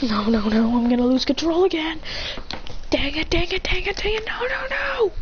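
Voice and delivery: climbing from normal to very high strain; acted escalating panic; rising panic. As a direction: let the pitch climb as control slips. Character fears losing control.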